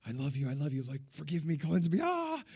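acted frantic prayer climbing to a high cry; desperate pleading. Retold desperate prayer, building to cry.

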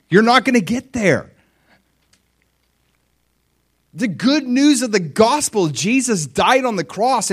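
loud, high, fervent preaching; preaching at full force. Acted. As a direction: drive the point home to the crowd.

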